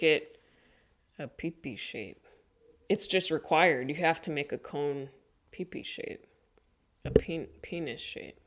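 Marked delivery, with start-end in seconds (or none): 1.2-2.3 "pee-pee shape" dropped low and much softer; hushed. Real, not acted. Embarrassed word in ceramics story. 5.5-8.4 "pee-pee shape" in an embarrassed whisper. Real, not acted. Embarrassed word in ceramics story.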